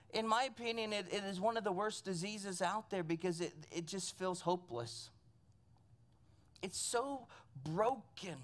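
low, hushed and sorrowful; heavy-hearted. Real, not acted. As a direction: let it sink softly into hopelessness. Dwelling on hopelessness of depression.

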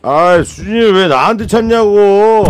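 high, loud, drawn-out yelling; angry shouting man. Retold or imitated. Groggy man shouting at a mom who called the wrong phone, loud and high. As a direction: blow up at the caller.

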